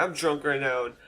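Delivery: trailing into mock-drunk babble; imitated drunk mumbling. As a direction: lose the words like a drunk friend. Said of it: "She couldn't even make words".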